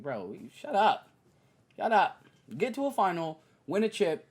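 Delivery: exasperated scolding; annoyed telling-off. Retold or imitated. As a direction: snap at him to shut up. Telling Paul George off.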